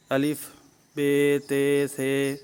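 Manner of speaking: letter names with Urdu vowels, "بے، تے، سے" ending in -ay; Urdu pronunciation. Shown as a demonstration. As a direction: recite "الف، بے، تے" the Urdu schoolroom way. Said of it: "اب اردو تلفظ کیا تھا".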